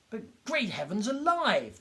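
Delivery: high, loud, slow outraged shout. A narrator's reading. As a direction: erupt in indignant fury. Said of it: "the little doctor shouted".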